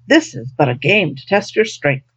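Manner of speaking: ordinary delivery, nothing marked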